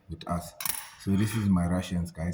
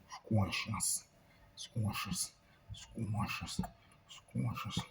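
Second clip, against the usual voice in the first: whispered repeat; 10 dB quieter, much slower than usual. Pretend. Repeated word sinking to whisper.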